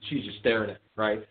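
normal talking voice

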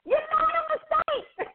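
high-pitched, squealed exclamation; emphatic exclamation. Real, not acted. Host playfully exclaiming encouragement to listeners, high squeal. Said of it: "You know I was gonna say it like that".

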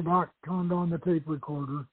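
plain reading voice

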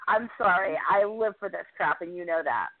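ordinary talk

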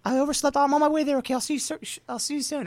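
high put-on girl voice, apologizing; girlish voice. Posing as a girl on the phone to lure him, girlish voice. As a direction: pitch it way up like a girl excusing herself. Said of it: "my girlish voice is possible".

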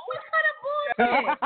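high outraged cry. Real, not acted. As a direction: cry out in disbelief. Outraged at a friend.

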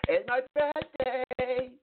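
slow, high sing-song mockery; mock birthday voice. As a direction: parody someone gushing about their birthday. Mocking people who celebrate birthdays.

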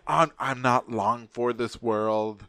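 very slow, drawn-out mock dying words; dying old man. Old dying patient in the next bed, slow lament. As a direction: drag it out like a fading old man. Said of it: "he was, like, saying".

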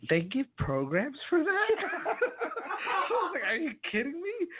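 high-pitched, laughing incredulity; amazed disbelief. Retold or imitated. Retelling his reaction to songwriting degrees, laughing disbelief. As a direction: squeal it slowly, astonished, breaking into a laugh.

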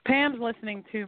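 normal talking voice

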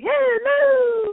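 very high, long-held comic hello; Mrs. Doubtfire. Retold or imitated. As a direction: imitate a hooting falsetto greeting. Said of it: "he's just like".

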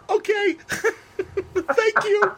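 very high, giggly starstruck squeak; mock-meek fanboy, laughing through it; starstruck kid. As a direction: squeak it out like an awed son. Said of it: "I felt like Jake Sisko".

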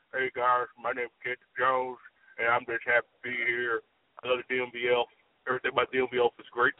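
mock the boss like he's gargling marbles; mouth full of marbles. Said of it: "it'll sound like this".

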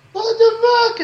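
high, squeaky indignant what the; mock the junkie's shocked outrage; startled junkie. Host voices the junkie's shocked reaction, high and indignant. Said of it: "she's like".

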